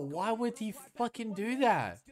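fed-up cry at a bad peek; exasperated outburst. Real, not acted. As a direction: demand an answer from the screen. Exasperated at player's misplay.

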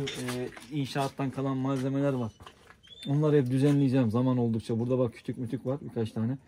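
usual speaking voice

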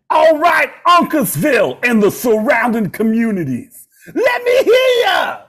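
high, loud rock-star stage yell. Retold or imitated. Rock singer shouting to the arena crowd, hammed-up. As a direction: belt it to the arena like a rock frontman. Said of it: "[name] on stage said".

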